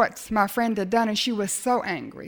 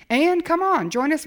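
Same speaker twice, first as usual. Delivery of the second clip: higher, louder coaxing call; 6 semitones higher, 4 dB louder than usual. Retold or imitated. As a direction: call her over warmly. Friend coaxing across room.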